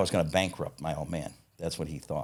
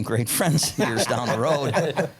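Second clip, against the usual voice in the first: louder, higher, laughing through the words; 7 semitones higher, 5 dB louder, much slower than usual. Real, not acted. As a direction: let a real laugh break into the line. Laughs while telling his story.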